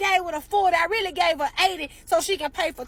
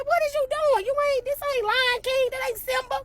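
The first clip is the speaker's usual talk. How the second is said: incredulous outburst; 8 semitones higher than usual. Retold or imitated. Shocked at daughter's behavior.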